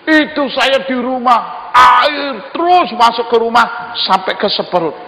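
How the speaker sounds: high, loud plea to God for help; desperate pleading. Acted. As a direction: cry out for rescue from the flood. Drowned man retells his pleas.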